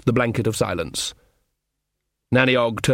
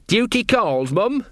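high-pitched earnest lad; dutiful son guard; 9 semitones higher, much slower than usual. A narrator's reading. Sean on guard answers his mother, high and earnest.

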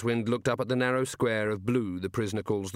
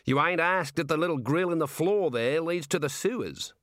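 higher, nudging Australian warder; lightly leading hint; prompting; 7 semitones higher than usual. A narrator's reading. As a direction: coax the prisoner to ask. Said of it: "the warder prompted".

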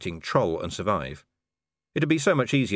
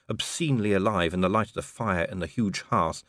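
ordinary delivery, nothing marked

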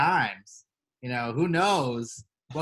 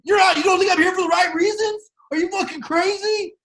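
high loud hammed-up yelling; over-the-top reality-show blowup; mock angry shout; 14 semitones higher, 6 dB louder than usual. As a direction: yell it like an offended contestant.

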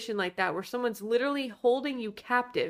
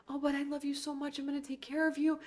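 soft, high mock-lovesick voice; hammed-up pathetic pleading, hushed; pathetic devotion; 5 semitones higher, 6 dB quieter, faster than usual. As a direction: simper it small and high, imitating a besotted man. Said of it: "never seen anything so pathetic".

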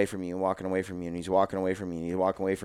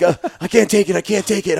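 much higher, louder, panicky wail; panicked; 10 semitones higher, 7 dB louder than usual. Retold or imitated. Brother-in-law pinned down in paintball, panicking loudly. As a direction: let the panic crack through, mock-panicked. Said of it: "He's like".

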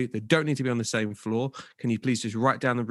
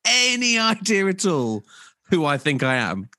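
hammed-up indignation, building higher and louder; mock self-important; 5 semitones higher, 4 dB louder than usual. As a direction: play the outraged big shot. Mock self-important star rant at staff.

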